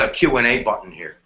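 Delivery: ordinary talk, nothing marked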